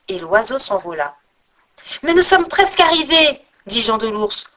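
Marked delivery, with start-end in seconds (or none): 2.0-3.4 high-pitched, urgent plea; urgent protest. A narrator's reading. Jean de l'Ours urges the tiring eagle on, high urgent plea. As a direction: press the point, pitched up high.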